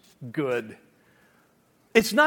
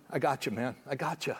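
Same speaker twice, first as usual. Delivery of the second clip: lower, softer reassurance from God; easy, hushed "I got you"; God comforting; 6 semitones lower, 8 dB quieter than usual. Retold or imitated. God reassuring someone who needs help, low and soft. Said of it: "God goes".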